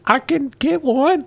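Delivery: high, slow hostess voice; Gamorrean woman. Acted. Hostess asked for a funnel, high and slow. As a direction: offer slowly in a high-pitched character voice. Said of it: "So she says".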